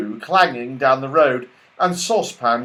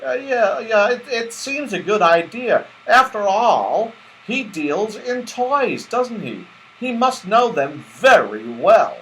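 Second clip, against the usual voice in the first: Moonface, musing; 9 semitones higher than usual. Acted. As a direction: weigh the idea aloud. Moonface voiced in story.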